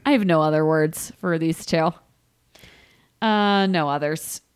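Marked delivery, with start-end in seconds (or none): none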